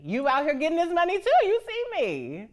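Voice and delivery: high, loud, quick comeback; sassy retort. Acted. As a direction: throw it right back at her. Acted retort to older woman.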